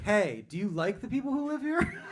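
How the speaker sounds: high, loud question with a sharp final rise; mischievously provocative. Retold or imitated. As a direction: blurt out the forbidden question, pitched high. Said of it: "irritated me so much that I was like".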